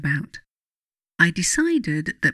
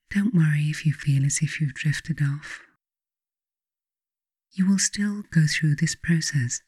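guided meditation. Acted. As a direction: ease the listener gently toward sleep. Leads a bedtime guided meditation.

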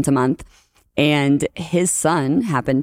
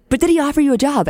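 impatient husband; 11 semitones higher, faster than usual. Retold or imitated. Husband pressing, retold.

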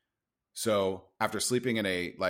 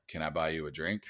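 lowered pickup-line voice; bass in voice; 6 semitones lower, 5 dB quieter than usual. Retold or imitated. Bar-goer offering a stranger a drink, putting bass in the voice. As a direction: drop into a lower, manly register. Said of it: "try to get the bass in my voice".

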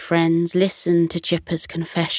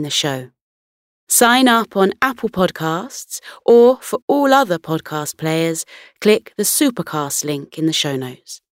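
bright, punchy sponsor read; upbeat ad read; 3 semitones higher, 5 dB louder than usual. Acted. Host pitching her listeners the club, upbeat ad read. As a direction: sell the club with energy.

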